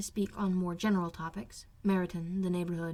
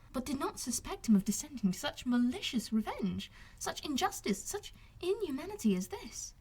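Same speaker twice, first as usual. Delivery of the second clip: put-on indignant young lady; 5 semitones higher than usual. Elizabeth judging Darcy to Wickham, higher and scandalised. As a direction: let the outrage rise to the end.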